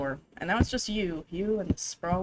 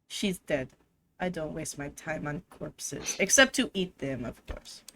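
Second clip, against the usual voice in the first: low, soft haughty French cat; mock French accent; 5 semitones lower, 5 dB quieter than usual. Player voicing the cat dismissing the dead aunt in a French accent. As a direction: purr it out like a snobbish French feline. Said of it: "this fucking accent".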